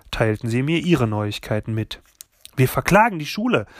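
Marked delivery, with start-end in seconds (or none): 2.6-3.7 higher, quick, delighted announcement; begeistert. A narrator's reading. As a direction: announce it eagerly, quick and high. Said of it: "voller Begeisterung". (voller Begeisterung)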